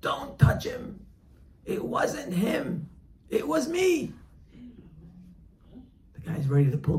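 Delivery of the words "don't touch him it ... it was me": desperate scream, much higher and louder. Acted. Friend at the gallows screams to save him, desperate high shout. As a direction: scream it out to stop the hanging. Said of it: "So he starts screaming".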